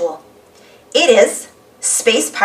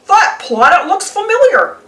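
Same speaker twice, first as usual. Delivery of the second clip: higher goofy cartoon voice; puzzled and dopey, pitched up; 7 semitones higher than usual. Goofy spotting the planet, puzzled. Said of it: "says Goofy".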